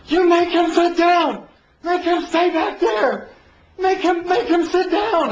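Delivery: high, shrill-pitched panicked yell, slowed; frantic yelling. Retold or imitated. Prosecutor yelling at the bailiff, high frantic demand. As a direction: demand the bailiff sit him down.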